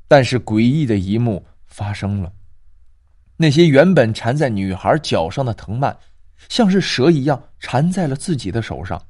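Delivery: ordinary delivery, nothing marked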